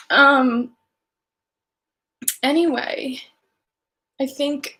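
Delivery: high, choked attempt to move on; tearful. Real, not acted. Singer trying to resume her livestream after crying, choked up. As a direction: push on through tears, pitched high. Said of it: "I don't cry on lives".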